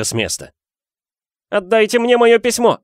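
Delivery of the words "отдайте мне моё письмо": high, loud furious demand; Harry yelling. A narrator's reading. Boy to uncle over stolen letter, high yell. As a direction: yell for what is yours. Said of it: "заорал он".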